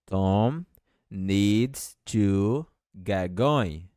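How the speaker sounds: each word spaced out, very slow; slow dictation. Shown as a demonstration. Teacher dictating slowly to Portuguese-speaking students. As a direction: spell it out slowly for learners. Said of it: "E essa frase, como fica?".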